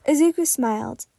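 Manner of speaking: ordinary delivery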